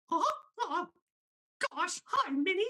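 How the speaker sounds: very high cartoon mouse voice; Minnie Mouse voice. Shown as a demonstration. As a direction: squeak it up into falsetto. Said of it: "I'm just gonna do Minnie Mouse first".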